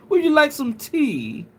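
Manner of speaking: "tea" with a crisp, posh T; put-on upper-class English accent; posh British accent. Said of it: "super posh".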